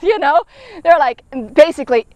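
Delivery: normal talking voice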